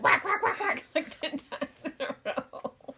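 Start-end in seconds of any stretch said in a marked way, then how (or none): 0.0-0.8 high loud playful whacks; mock whacks. Daughter teasing mom about her whacking. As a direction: tease with the whacks. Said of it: "you were like".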